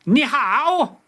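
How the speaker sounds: Ni hao, a mock Mandarin greeting. Shown as a demonstration. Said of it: "Say ni hao".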